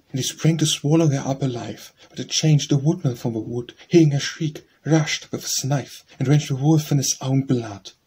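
loud, rising storyteller's climax; dramatic climax. A narrator's reading. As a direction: read the rescue big and urgent. Narrator builds the climax.

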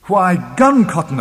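high, loud, eager burst; excited suggestion. A narrator's reading. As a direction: announce the obvious solution with relish. Proposing to blast the rock.

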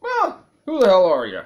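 high, loud outburst of demand; indignant shout. Acted. Player demanding who the odd character is, indignant shout. Blurts the game's question at character.

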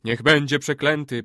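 loud group echo, falling; warriors' chorus. A narrator's reading. All the warriors repeating the old man's curse, loud choral echo. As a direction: repeat the curse as a crowd response. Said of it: "powtórzyli wszyscy wojownicy".

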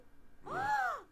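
a high sudden gasp of shock; startled high gasp; sharp shocked gasp. Real, not acted. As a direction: gasp as if something awful happened. Thinks the sim died.

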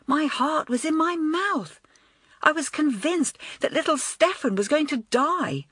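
a lightly voiced girl caught up in the story; light emotional girl; moved schoolgirl. A narrator's reading. Joan reliving the film.

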